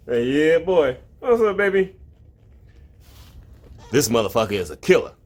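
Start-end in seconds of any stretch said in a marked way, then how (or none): 0.1-2.0 pet talk. Real, not acted. Praising the cat's catch.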